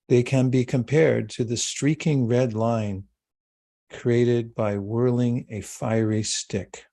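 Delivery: measured, calm reading of a sacred verse; scripture reading. Acted. Teacher reading a verse to the meditating class.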